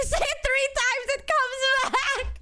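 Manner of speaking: high, slow giggly ghost-story voice; spooky tease through laughter; laughing spooky. Pretend. Teasing chat with a spooky legend, laughing.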